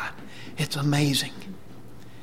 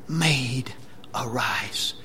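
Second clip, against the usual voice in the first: hushed repeat, dropping low at the end; quiet, reverent retold command; gentle command; 4 semitones lower, 4 dB quieter, much slower than usual. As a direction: repeat the summons softly. Quotes Jesus's words again, hushed.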